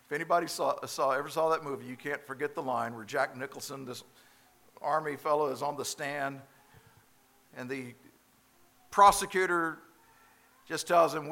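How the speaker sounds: ordinary talk, nothing marked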